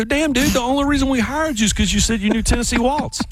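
high, strained mock outrage at the bandleader; exasperated disbelief. Groom to bandleader who can't play their song, high exasperated disbelief. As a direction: squeak it out like you can't believe it. Retold outburst at band leader.

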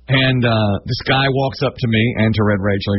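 ordinary talk

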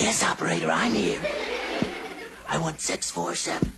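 low, breaking croak; laryngitis. Acted. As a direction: push words through a lost voice. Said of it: "he has laryngitis".

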